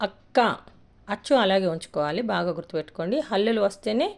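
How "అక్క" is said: అక్క said louder with a firm doubled క్క; stressed double k. Shown as a demonstration. Teacher showing students the strong క్క in అక్క. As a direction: press hard on the క్క of అక్క. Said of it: "ఇక్కడ ఎలా పలుకుతున్నాం".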